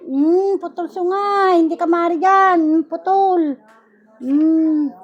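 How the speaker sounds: loud, commanding rebuke of a spirit; healer's stern banishing chant; forceful exorcism commands. Acted. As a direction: drive the demon out with each word. Casts out evil spirit, commanding.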